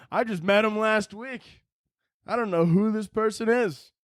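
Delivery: high, hammed-up protest; high whiny self-saving excuse; mock panicked disowning. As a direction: plead comically that you're not involved. Acts disowning companion to robber.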